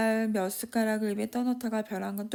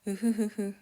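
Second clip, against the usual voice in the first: put-on a drunk man's pleased little laugh; drunk chuckle. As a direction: chuckle to yourself like a tipsy father.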